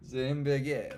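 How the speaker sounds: loud, hammed-up French accent; French-sounding accent. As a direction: attempt German, land on French accent. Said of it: "it's French".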